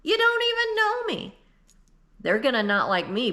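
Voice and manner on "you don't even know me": sudden high, hurt indignant whine. Retold or imitated. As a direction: squeak it out, wounded and outraged. Hurt outrage at strangers' dislike.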